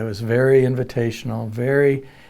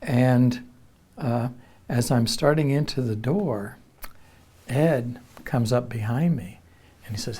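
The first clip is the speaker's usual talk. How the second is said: ordinary delivery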